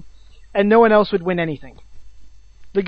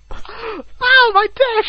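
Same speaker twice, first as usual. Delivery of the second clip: mock scream; 13 semitones higher, much slower than usual. Friend imitating the screaming guy, mock yell. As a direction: yelp it in comic agony.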